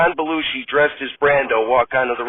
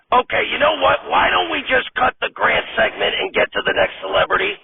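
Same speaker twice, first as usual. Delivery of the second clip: much higher, whiny director voice; fussy director; 8 semitones higher than usual. Acted. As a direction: play a fed-up, high-pitched director. Director gives up.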